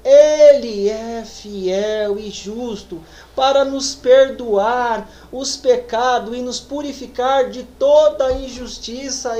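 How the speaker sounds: drawn-out, weighty scripture reading; slow, solemn verse. Acted. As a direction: read each word slowly as holy text.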